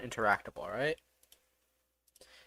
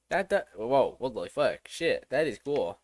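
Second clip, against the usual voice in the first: ordinary delivery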